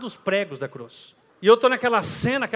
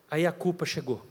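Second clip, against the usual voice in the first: ordinary talk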